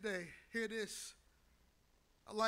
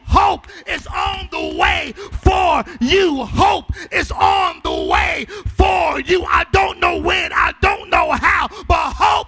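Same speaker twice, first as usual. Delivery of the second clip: loud, higher, ringing whoop; preaching at full force; 7 semitones higher, 23 dB louder than usual. Real, not acted. Pastor to grieving congregation, ringing climactic preaching. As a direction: drive the hope home.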